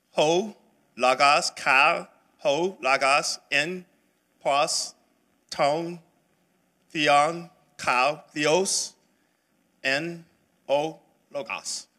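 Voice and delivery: slow, careful koine Greek, "ho, logos, kai, ho, logos"; reading in Greek. Shown as a demonstration. Teacher reads the Greek verse to the class, slow and deliberate. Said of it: "What I just said is this in Greek".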